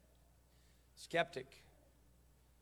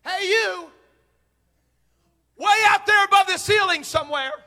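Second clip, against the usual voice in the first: high, raised far-off holler; mock calling out; 13 semitones higher, 12 dB louder than usual. Calling to God above the ceiling, mock holler. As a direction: hail someone very far away.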